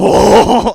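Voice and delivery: loud, high, drawn-out fake laugh. Mate faking a laugh to encourage him, forced laugh. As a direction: imitate a stupid, forced laugh. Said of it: "what did his fake laugh sound like?".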